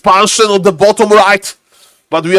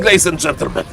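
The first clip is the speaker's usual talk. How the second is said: deep put-on voice; 6 semitones lower, 5 dB quieter, faster than usual. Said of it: "That's why I'm talking like this".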